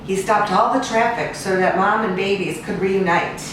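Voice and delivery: ordinary delivery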